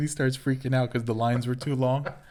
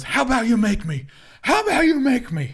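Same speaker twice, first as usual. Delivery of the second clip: high-pitched imitation of a defiant woman; mock defiant comeback; 11 semitones higher, 4 dB louder than usual. Woman answering back in a retold store argument, mock defiance. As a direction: throw it back as a mocking, high-voiced dare.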